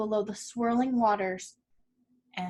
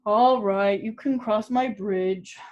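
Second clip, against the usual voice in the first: rumbling troll; 5 dB louder than usual. A narrator's reading. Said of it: "he rumbled".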